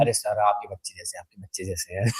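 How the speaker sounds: imitating a fond policeman letting him off; sweet-talking cop. Police वाले की नकल. (चालान माफ़ करता पुलिस वाला, धीमी नरम आवाज़ में)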